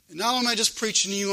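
ordinary talk

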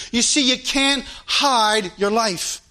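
ordinary talk, nothing marked

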